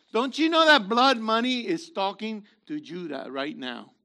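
ordinary talk, nothing marked

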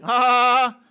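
loud burst of real laughter; hearty laugh. Real, not acted. Preacher laughing loudly at the verse's wording.

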